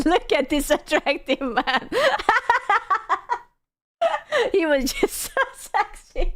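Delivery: giggly, gushing crush talk; giggling. Real, not acted. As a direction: laugh through it like a smitten fan. Gushing about a cartoon crush.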